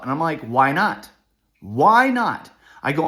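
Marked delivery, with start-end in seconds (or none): 1.6-2.5 "Why not?" much higher and louder; insistent demand. Retold or imitated. Father pressing the school staff, loud high "Why not?". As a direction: push the second "Why not?" up hard. Repeated, pushed harder.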